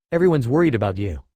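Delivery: ordinary delivery, nothing marked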